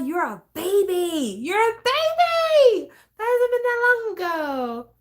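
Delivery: high teasing sing-song. Real, not acted. Teasing a listener's young age.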